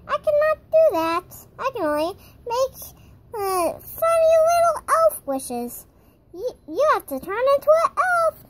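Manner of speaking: high, loud little elf; elf troll voice. Pretend. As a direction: squeak it out like a tiny magic elf. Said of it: "I am a little elf troll".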